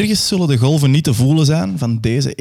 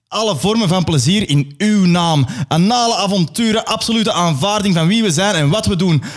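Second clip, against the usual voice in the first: 1 adoring crowd; 7 semitones higher than usual. Acted. Crowd answers the leader.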